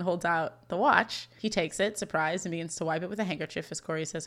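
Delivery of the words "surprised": ordinary talk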